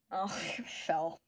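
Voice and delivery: soft, slow whisper with a laugh; whispered aside. Real, not acted. Gum fell, whispered aside.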